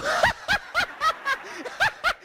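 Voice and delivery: audience roaring with laughter; big crowd laugh. Real, not acted.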